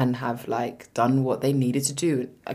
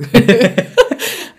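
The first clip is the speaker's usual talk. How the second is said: loud laugh; 10 semitones higher, 9 dB louder than usual. Real, not acted. Cackling at the joke.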